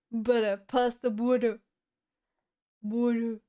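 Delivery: teasing British butter impression; British accent. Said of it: "Why is British, like, always saying butter?".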